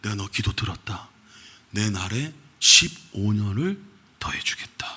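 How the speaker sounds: hushed reassuring divine voice; tender God. Retold or imitated. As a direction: speak it as a gentle promise. God answers the king gently.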